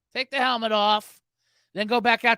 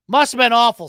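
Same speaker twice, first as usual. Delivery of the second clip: louder mock sympathy; loud sarcasm; 3 semitones higher, 10 dB louder than usual. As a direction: say it loudly with fake pity.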